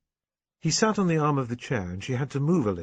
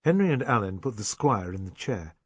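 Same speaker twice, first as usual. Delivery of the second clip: ordinary delivery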